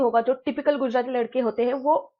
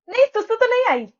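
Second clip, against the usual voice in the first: high, loud awkward blurt; high loud embarrassed reply; flustered blurt; 11 semitones higher, 6 dB louder than usual. Retold or imitated. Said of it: "aura मेरा minus करते हुए बोली".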